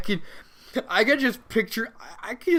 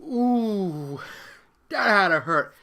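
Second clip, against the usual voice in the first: usual speaking voice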